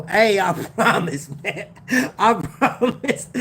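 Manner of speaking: amused, laughing as you swear it; laughing insistence. Real, not acted. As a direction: promise through chuckles. Laughing while swearing he means it.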